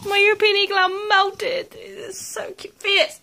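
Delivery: high, loud excited gushing; squeaky praising a dog. Real, not acted. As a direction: squeal it with delight. Gushing loudly at puppy.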